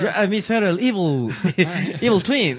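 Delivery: broken Spanish in a mock German accent; hammed-up German villain accent. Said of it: "regresó con acento alemán".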